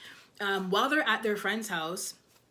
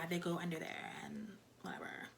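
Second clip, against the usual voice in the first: whispered, dismissive and much softer; 3 semitones lower, 12 dB quieter than usual. Retold or imitated. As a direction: mutter it under your breath like you don't care. Molly's dismissive reply, retold.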